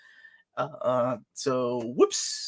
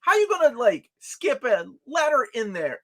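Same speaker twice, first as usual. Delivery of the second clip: incredulous; 9 semitones higher than usual. Real, not acted. Mock outrage at spelling.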